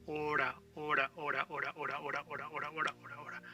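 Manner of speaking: "hora" rattled off over and over, H silent; "hora" in a quick trailing chain; repeated drill. Shown as a demonstration. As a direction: repeat "hora" rapidly with tapped r. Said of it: "Now I repeat it".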